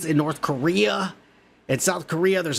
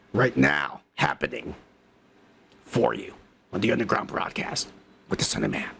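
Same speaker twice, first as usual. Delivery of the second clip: much lower, deep announcer voice; announcer outro; 7 semitones lower than usual. Acted. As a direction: drop into a deep broadcast sign-off.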